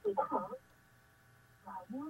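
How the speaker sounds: faint echo fading away; distant radio feedback, fading out; last word echo. Real, not acted. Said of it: "cái tiếng vang nó làm cho Thảo My không nghe được".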